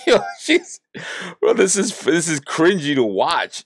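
cry-laughing up high, wincing at the screen; cringing laugh. Real, not acted. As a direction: squeal it out, pained by what you're watching. Cringing while watching the clip.

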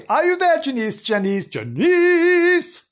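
high, loud call across the distance; hailing Janice from afar. Real, not acted. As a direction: call her name to find her. Calling out to Janice.

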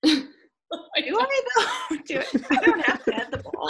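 high-pitched, amused mock disbelief; laughing retort. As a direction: protest through laughter as you retell it. Laughs retelling her own reply.

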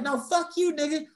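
high-pitched mimicked drunk girl; acted squealed comeback; defiant retort. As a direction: imitate her high, sassy refusal. Drunk girls talking back.

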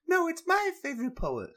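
very high, loud and fast, stress on my; squeaky high rebuttal. Retold or imitated. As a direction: snap back at him in a shrill-pitched voice. Argument, second voice.

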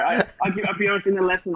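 ordinary talk, nothing marked

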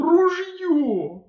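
gurgling cry. A narrator's reading. Drowning hunter cries again.

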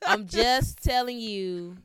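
slow, loud, laughing through it. Real, not acted. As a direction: drag it out while laughing. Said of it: "this is so nasty".

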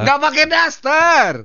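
high, slow, drawn-out cry; hammed-up high exclamation of disbelief; mock outraged exclamation. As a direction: blurt it high as the meaning dawns. Exclaims the same words in mock shock.

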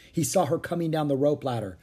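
ordinary delivery, nothing marked

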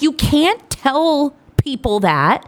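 high, slow telling-off; mock-mortified scolding. Embarrassed mom corrects her son, mock-mortified. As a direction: drag out a retold reproach to a kid.

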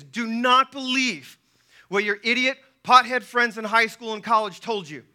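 ordinary talk, nothing marked

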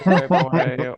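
laughing the words out. Real, not acted. Cohost echoing the soy boy joke, laughing. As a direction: repeat it through a laugh.

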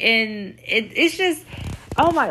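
ordinary talk, nothing marked

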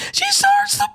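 high squeal leading into the word; squealing setup. Real, not acted. Mocking the girl who starts to blush, high squeal. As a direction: squeak it up high, then drop. Repeating the bit.

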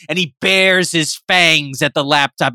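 ordinary delivery

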